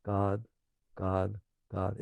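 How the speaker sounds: faint background chant; mantra in the background. Shown as a demonstration. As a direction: let it hum along under the thoughts. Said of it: "In the background".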